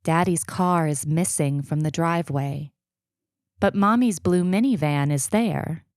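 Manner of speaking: usual speaking voice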